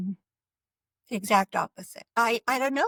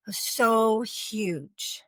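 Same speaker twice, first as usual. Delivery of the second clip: usual speaking voice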